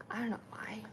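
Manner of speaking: a breathy, puzzled whisper; whispered aside. Real, not acted. To her viewers about a sore thumb, a puzzled whisper. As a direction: whisper it, half to herself.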